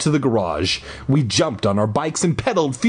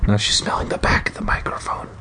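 whispering; 3 semitones lower, faster than usual. Real, not acted. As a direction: whisper it so the mic barely hears. Aside about cat at mic.

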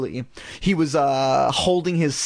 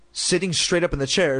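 plain reading voice

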